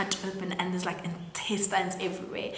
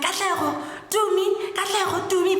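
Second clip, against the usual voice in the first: high, loud frantic calling; 10 semitones higher, 3 dB louder than usual. Retold or imitated. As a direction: call their names through the door in panic. Scared boy banging on door.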